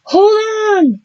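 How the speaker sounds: high, loud plea; desperate call; urgent shout. Acted. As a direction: shout to her across the hall. Willow shouting to dragged Robin.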